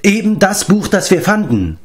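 high-pitched astonished remark; amazed exclamation. A narrator's reading. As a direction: call it out in amazement. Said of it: "rief Prinz Run". (erstaunt rufend)

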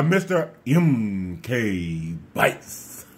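slow, low put-on voice, drawn out; Mister M K Bites voice. Sign-off to the Legion in his lightly put-on Mister M K Bites voice. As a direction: drop low and stretch it like a character. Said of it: "in my best voice".

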